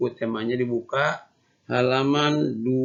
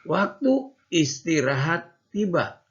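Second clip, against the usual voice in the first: slow, higher storybook reading; reading to children; 6 semitones higher, 4 dB louder, slower than usual. Shown as a demonstration. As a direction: read each word clearly for little kids. Teacher reads story to class.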